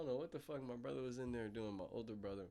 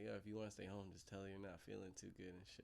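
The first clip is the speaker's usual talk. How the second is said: conspiratorial aside; 2 semitones lower, 11 dB quieter than usual. Retold or imitated. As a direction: quietly put the idea to him, softly. Secretly coaching brother to skip school.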